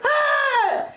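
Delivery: high mock yell, playing the panicked helper; hammed-up scream of someone watching a birth. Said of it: "you guys were probably both screaming".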